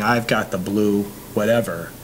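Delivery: usual speaking voice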